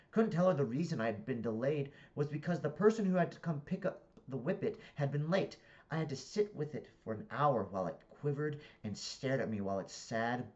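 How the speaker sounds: deliberate, projected book reading; story narration. A narrator's reading. Reader narrating a comic novel to listeners, animated narration.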